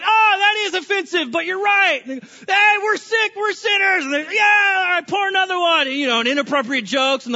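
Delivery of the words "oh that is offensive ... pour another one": loud, high, shouting party cheer; rowdy buddies. Retold or imitated. Levi's buddies cheering at the party, loud rowdy shout. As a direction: whoop it up like drunk friends. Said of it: "Levi's buddies are going".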